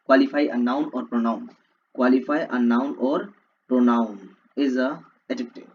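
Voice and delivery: very slow, word by word definition; slow dictation. Shown as a demonstration. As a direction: read it out slowly for notes. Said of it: "reading definition slowly". (धीरे धीरे बोलकर लिखवाना)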